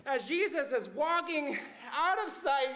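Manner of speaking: ordinary delivery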